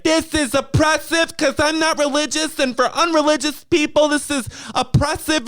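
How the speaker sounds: high-pitched imitation of an aggrieved girl; mock her sense of being oppressed in a high voice; mock oppressed complaint. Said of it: "she was talking like she was being oppressed".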